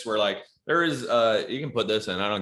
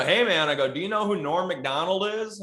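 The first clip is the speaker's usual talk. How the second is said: put-on teasing kid; 9 semitones higher than usual. Quoting himself addressing the kid.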